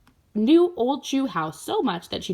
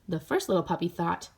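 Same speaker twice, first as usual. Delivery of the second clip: usual speaking voice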